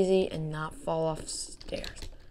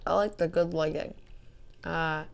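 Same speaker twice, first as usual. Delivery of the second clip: ordinary talk, nothing marked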